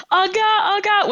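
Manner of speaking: high, hammed-up panic of a guard; a grown man squealing oh God; mock scared guard. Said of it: "comes screaming down".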